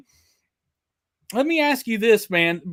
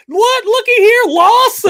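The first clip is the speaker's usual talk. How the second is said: much higher, louder gleeful call; high, loud excited welcome; excited greeting; 12 semitones higher, 8 dB louder than usual. Real, not acted. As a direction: shout out the new viewer with delight. Greeting new viewer excitedly.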